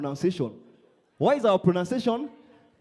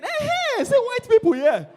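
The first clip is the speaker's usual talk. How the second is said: high, loud exclamation; excited whoop; 6 dB louder than usual. Pretend. Comedian finds a visitor from Spain, high excited whoop. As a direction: crow it in delight.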